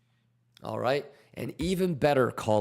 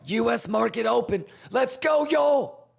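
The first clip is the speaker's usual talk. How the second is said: high, loud hype yelling; hype shouting; 12 semitones higher, 3 dB louder than usual. Real, not acted. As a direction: hype the chat up hard. Market open hype.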